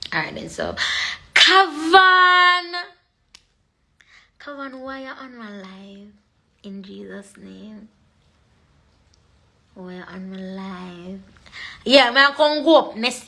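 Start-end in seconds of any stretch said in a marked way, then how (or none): 1.3-2.7 loud, high drawn-out call; calling out a name. Real, not acted. Calls out a viewer on live. 4.4-11.2 very soft, drawn-out aside; hushed questioning. Real, not acted. Pleads with viewer after calling him.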